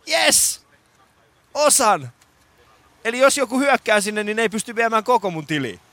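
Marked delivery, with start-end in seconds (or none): 1.5-2.1 osan punched high and falling; lyö sana osan korkealta ja laskien; stress on osan. Acted. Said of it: "Siis osan!". (osan painokkaasti ja ivallisesti)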